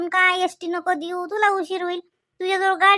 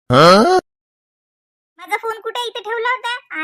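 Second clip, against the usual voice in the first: loud slow call-out; 4 semitones higher, 9 dB louder, much slower than usual. Acted. Wife hunting for her phone.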